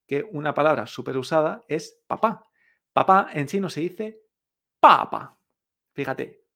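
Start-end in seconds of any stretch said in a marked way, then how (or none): 4.8-5.4 "papa" with a loud high-falling first syllable, light second; fourth tone, then toneless. Shown as a demonstration. Teacher modelling Chinese for dad, "papa" punched then light. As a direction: punch the first "pa" and let the second drop away. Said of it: "el cuarto tono y el sin tono".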